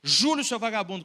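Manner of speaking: cursing yell. Retold or imitated. Father shaking his son and cursing him, loud. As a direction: shout the curse at him. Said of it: "bufando com ele, praguejando".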